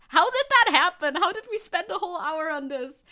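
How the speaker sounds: high, loud astonishment easing slightly; exclaimed disbelief. Real, not acted. Streamer shocked a whole hour went by, loud disbelief.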